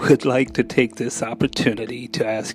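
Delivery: deadpan public-service-announcement read over music; mock announcement. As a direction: read it like a dry community bulletin.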